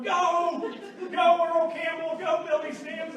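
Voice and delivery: loud, high mock cheer from the bleachers; hammed-up cheering, high and loud; sideline parent cheering. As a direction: yell it like a football parent cheering a kid. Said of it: "every mom and dad sitting over there for their four-year-old going".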